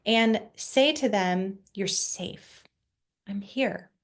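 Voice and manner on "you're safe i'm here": put-on soft, hushed reassurance to a scared child; calm reassuring voice. Shown as a demonstration. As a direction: whisper it gently, slowly calming. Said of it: "using a calm, slow, reassuring voice".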